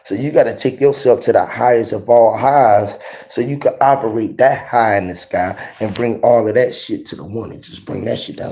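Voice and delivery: normal talking voice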